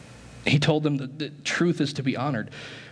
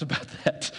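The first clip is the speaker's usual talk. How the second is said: ordinary talk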